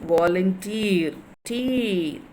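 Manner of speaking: volunteer slowly, its final TEER held long, then repeated alone; prolonged last syllable. Shown as a demonstration. Teacher showing last-syllable stress, drawing out the end of volunteer. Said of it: "you are, you are prolonging".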